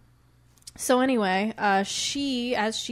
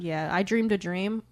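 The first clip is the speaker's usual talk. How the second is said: ordinary talk, nothing marked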